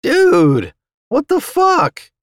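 loud, high outcry, slowing at the end; pained protest. A narrator's reading. Fighter to vampire punching him, pained protest. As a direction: cry out, pitch leaping very high.

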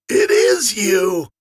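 put-on old soldier greeting his lost brother; joyous. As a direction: burst out with delight. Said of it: "he said joyously".

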